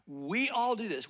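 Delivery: ordinary delivery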